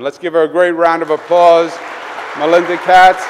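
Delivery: loud, rising announcer-like call; rallying applause. Real, not acted. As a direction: build the name up for the crowd.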